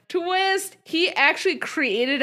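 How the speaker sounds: spring the twist on the listeners; one-word gasp of a plot twist; dramatic reveal. Real, not acted. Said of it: "announcing a plot twist".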